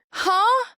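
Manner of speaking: high, bewildered "huh?"; a lost, disbelieving squeak; stunned huh. Retold or imitated. Patient left reeling after the doctor's rapid plans, high and bewildered.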